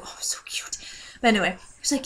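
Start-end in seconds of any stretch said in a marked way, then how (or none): 0.0-0.8 whispered gush. Real, not acted. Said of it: "gushing over the beagle".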